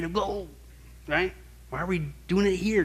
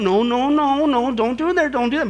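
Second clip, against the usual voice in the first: louder, higher urgent warning; firmer correction; 8 semitones higher, 4 dB louder than usual. Acted. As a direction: step it up and insist firmly. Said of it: "getting a little more firm".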